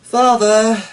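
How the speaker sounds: loud, high calling out; called out. A narrator's reading. Daughter calling to her father across the field, loud. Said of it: "called Eleanor".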